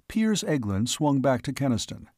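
usual speaking voice

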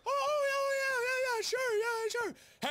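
very high, over-eager yeses; mock agreement. Settlers humouring Native Americans, falsely eager high voice. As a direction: gush the yeses in a squeaky put-on voice.

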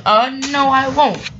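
high, loud snappy refusal; snapped refusal. Acted. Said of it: "snapped Samson".

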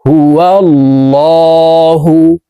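"হুয়াল্লাহু" with thick, dark l and held vowel; heavy lam. Shown as a demonstration. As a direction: chant "হুয়াল্লাহু" slowly, l made heavy. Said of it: "মোটাভাবে এই লামকে পড়তে হবে".

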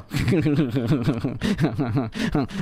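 low, drawn-out chuckling laugh; long chuckle. Real, not acted. Laughs at the Riga alliance. (długi, niski chichot)

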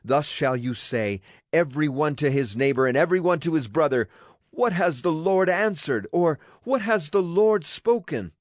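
plain reading voice

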